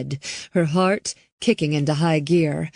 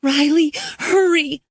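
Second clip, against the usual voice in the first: much higher and louder, frantic cry; desperate plea; 11 semitones higher, 4 dB louder than usual. Acted. Girl crying out to Riley, frantic. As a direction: beg her to hurry.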